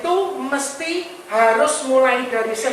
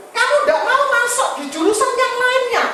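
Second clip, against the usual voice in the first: put-on higher, quicker, laughing coaxing to his child; father coaxing his youngest about a major, higher and laughing; 10 semitones higher than usual. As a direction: talk the kid into it with a laugh. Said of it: "Saya ngomong gitu".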